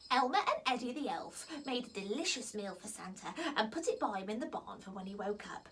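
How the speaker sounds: ordinary delivery, nothing marked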